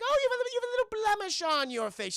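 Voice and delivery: lightly voiced sneering leprechaun, teasing; mocking taunt. Leprechaun teases Zendaya about her blemish, high mocking voice.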